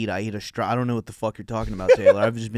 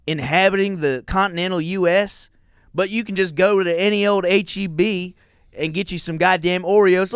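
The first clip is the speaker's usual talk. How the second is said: loud, high-pitched Southern rant; hammed-up Southern indignation; Southern accent; 8 semitones higher, 7 dB louder than usual. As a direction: rail like an outraged Southerner, putting it on. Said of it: "I'll read 'em in a Southern accent".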